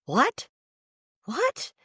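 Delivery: incredulous, pitch leaping up on each word; acted-out baffled double take; retold disbelief. Storyteller replaying her reaction to news in hell, incredulous. Retold shock at being dead.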